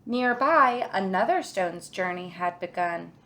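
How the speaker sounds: ordinary delivery, nothing marked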